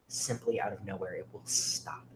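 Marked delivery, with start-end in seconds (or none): none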